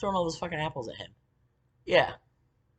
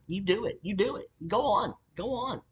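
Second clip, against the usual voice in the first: high, punchy retold goading; goading, pitched well up; dismissive dare; 7 semitones higher than usual. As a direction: wave them off with repeated high jabs.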